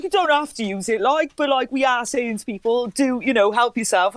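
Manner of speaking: chirpy Welsh bloke offering sanitizer; lightly voiced friendly Welsh doorman. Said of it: "He was like".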